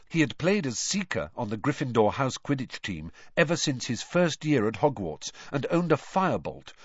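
plain reading voice

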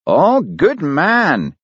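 high, drawn-out delighted praise; exclaiming. A narrator's reading. Police boss praises Fox's offer, slow high exclamation. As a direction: stretch it out with swooping high pitch. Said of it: "exclaimed Daviot".

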